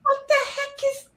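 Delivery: very high-pitched toddler impression; little kid's voice. Dad showing how his kid says it, squeaky child voice. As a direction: imitate a tiny child, way up high. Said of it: "It's so funny hearing that little voice".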